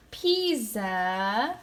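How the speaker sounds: Pisa stretched slowly, PEEE-zaaa; drawn-out pronunciation. Shown as a demonstration. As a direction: draw out both syllables of Pisa with a buzzing z. Contrasting Pisa with pizza.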